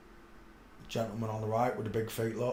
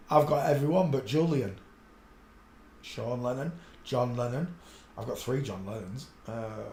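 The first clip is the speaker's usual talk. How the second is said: ordinary delivery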